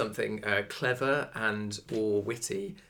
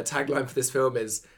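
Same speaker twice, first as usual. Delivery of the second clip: ordinary talk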